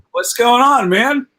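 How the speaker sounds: high, loud retold hello; eager greeting. Comic greeting Gene in the hallway, eager greeting. Acting his own flustered greeting.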